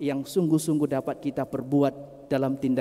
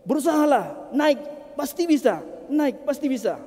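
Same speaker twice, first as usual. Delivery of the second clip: urging call; 12 semitones higher, 4 dB louder than usual. Acted. First passer-by urges from above.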